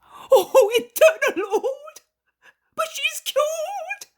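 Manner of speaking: very loud, high cry of joy breaking into tears; joyful weeping cry. A narrator's reading. Innkeeper sees his daughter cured, crying with joy. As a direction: laugh and sob at once with relief.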